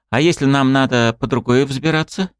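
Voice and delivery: worried high question; frightened. A narrator's reading. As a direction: ask it nervously. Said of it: "испуганно спросила моя спутница".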